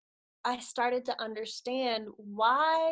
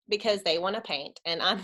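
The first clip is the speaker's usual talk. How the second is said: usual speaking voice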